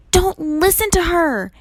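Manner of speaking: high, loud urgent shout; shouted warning. A narrator's reading. Annabeth warns Percy off the monster, shouting. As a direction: call out a desperate warning. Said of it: "Annabeth's voice shouted".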